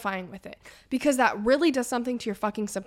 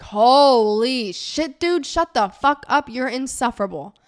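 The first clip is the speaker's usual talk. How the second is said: exasperated; 3 semitones higher, 4 dB louder, slower than usual. Pretend. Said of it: "you're like".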